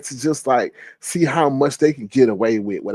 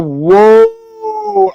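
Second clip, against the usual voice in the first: shocked whoa; 7 dB louder than usual. Retold or imitated. Reacting to shocking remark.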